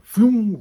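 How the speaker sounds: sudden loud high whoosh; yank sound effect. Pretend. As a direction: make the pop of the tooth ripping free. Imagines tooth yanked out.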